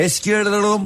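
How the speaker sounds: high sing-song junk call; melodic street vendor shout. Retold or imitated. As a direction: croon it like a street junk buyer. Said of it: "Eskici yapardım sana". (melodik eskici bağırışı)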